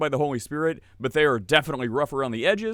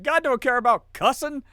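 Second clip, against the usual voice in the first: high-pitched, louder caricature; mock dismissive believer; 12 semitones higher, 5 dB louder than usual. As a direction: parody a believer shrugging off the rules. Mimics Christians defending swearing.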